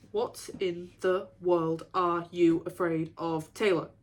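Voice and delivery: slow, word-by-word imitation; measured, separated words at a slow pace; Danny's way of talking. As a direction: space each word out deliberately, mimicking someone. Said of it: "He speaks in nines".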